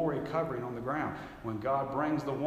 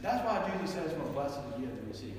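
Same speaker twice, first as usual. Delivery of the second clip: put-on old man quoted; 2 semitones higher than usual. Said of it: "and he said".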